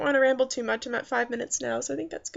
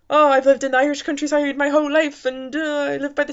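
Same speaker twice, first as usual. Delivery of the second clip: a hammed-up Irish accent, louder and higher; 4 semitones higher, 5 dB louder than usual. Reviewer mimicking a colorful Irish movie character, mock Irish accent. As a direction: play the quirky Irish villager in a put-on Irish lilt. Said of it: "colorful Irish".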